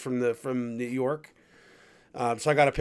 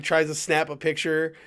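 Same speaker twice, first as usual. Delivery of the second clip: usual speaking voice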